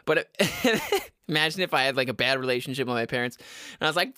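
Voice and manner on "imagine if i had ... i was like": usual speaking voice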